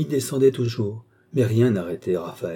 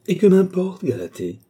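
higher and louder, flung out, then dropping; impetuous outburst; 9 semitones higher, 5 dB louder than usual. A narrator's reading. Painter dismissing his Galatée to Stella, impetuous outburst. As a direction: brush her worry aside with sudden passion. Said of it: "lui dit impétueusement le peintre".

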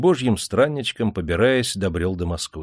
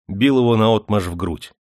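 plain reading voice

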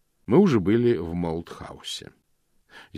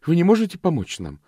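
high, louder imploring question; a young woman begging the housekeeper; pleading; 10 semitones higher, 6 dB louder than usual. A narrator's reading. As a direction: beg her for help. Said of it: "умоляюще спросила Фенелла".

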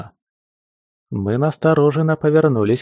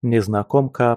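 ordinary delivery

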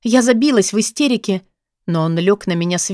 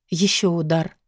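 ordinary delivery, nothing marked

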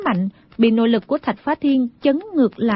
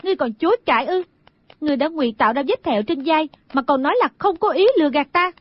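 fast, higher-pitched scolding; angry accusation; 4 semitones higher, much faster than usual. Acted. Girl accusing him over the faked scar, angry. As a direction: rattle off the charge in a raised pitch. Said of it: "Đinh Đan giận dữ nói".

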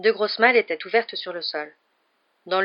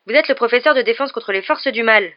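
louder, higher protest; cutting in; 4 semitones higher, 6 dB louder than usual. A narrator's reading. Pupil interrupts his fleeing teacher, protesting. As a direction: break in forcefully on his excuse. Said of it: "coupa Harry".

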